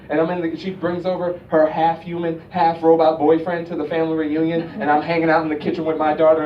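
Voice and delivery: normal talking voice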